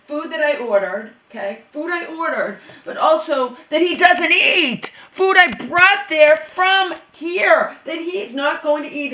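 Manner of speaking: furious about her food, loud and slow; indignant outburst. Real, not acted. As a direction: hammer home the outrage. Indignant rant over her food.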